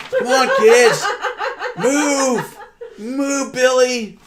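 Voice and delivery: loud playful yell with laughter; shouting at kids. Pretend. Yelling at kids to dodge.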